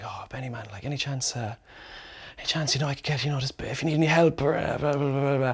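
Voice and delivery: put-on shy teenager barely daring to ask; meek, nervous quiet request; nervous mumble. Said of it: "real nervous".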